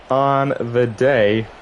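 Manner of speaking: usual speaking voice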